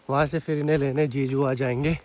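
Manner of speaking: normal talking voice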